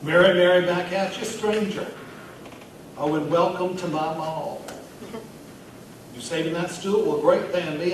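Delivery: chatty pet-store manager at a bar; Vernon Ricketts. Acted. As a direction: play a festive mall regular chatting up a stranger. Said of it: "read it in the voice of the character".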